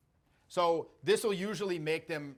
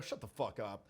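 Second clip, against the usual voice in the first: lower, softer, unbothered; calm dismissal; 7 semitones lower, 8 dB quieter than usual. Shown as a demonstration. He dismisses someone's complaint, low and calm. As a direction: brush it off low and easy. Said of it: "I'm like".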